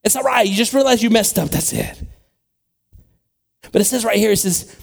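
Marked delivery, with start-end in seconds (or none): 0.0-2.0 high, excited, brushing it all off; easygoing imagined Peter. Acted. Preacher imagining Peter excusing the crowd's mistakes, easygoing reassurance. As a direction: let them off the hook in a raised, insistent voice. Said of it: "he could have just been like".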